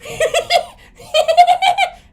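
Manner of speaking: high, loud villain cackle; evil cackle. Retold or imitated. Dart guy cackles as he runs away, high and loud. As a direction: cackle like a prankster fleeing.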